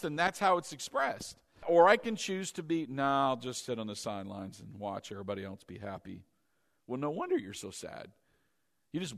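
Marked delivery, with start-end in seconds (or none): 2.9-6.2 low, soft and listless; bored sideliner. Acted. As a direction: shrug it off from the sidelines. Acted bored sideline-sitter.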